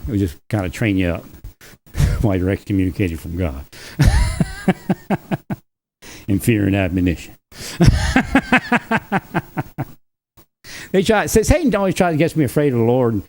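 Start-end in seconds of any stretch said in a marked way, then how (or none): 4.0-5.5 long high-pitched laugh in quick bursts; real rattling belly laugh, pitched high; hearty laugh. Real, not acted. Tickled by his own excommunication threat, hearty laugh. 7.6-9.8 long high-pitched laugh in quick bursts; real rattling belly laugh, pitched high; hearty laugh. Real, not acted. Tickled by his own excommunication threat, hearty laugh.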